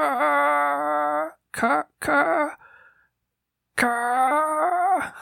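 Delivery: put-on high, halting old man stutter; old man stuck on his own name; stammering old man. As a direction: stall on the first sound. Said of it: "looks over at Jonas and says".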